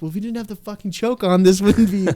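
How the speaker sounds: high-pitched, indignant comeback; exasperated retort. Retold or imitated. Seller answering the buddy blaming the bike, exasperated retort. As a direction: fire back in mock exasperation, pitched well up.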